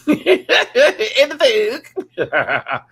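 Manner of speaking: laughing through the words, slowly drawn out. Real, not acted. Excited about a night of gaming, laughing. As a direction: giggle the words out slow. Giggles over game plans.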